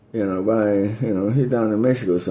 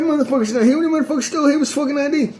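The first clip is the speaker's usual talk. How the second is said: high, strained put-on voice for the other man; voicing the other guy. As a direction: squeeze it up high like a different person protesting. Voicing shot guy's protest.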